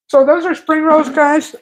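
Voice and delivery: talking through a mouthful; words round a bite of food; mouth full. Real, not acted. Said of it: "I'm trying to talk with my mouth full".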